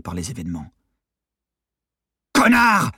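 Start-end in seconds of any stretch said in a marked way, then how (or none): 2.3-2.9 very high, furious yell; shouted insult. A narrator's reading. Detainee curses his frozen accomplice, screamed insult. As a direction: hurl the insult at full force. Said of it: "cria encore Novakovski".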